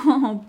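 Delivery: bright teasing laugh; a quick delighted laugh; amused laugh. Acted.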